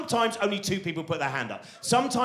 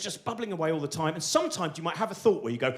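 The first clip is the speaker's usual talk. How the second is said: ordinary talk, nothing marked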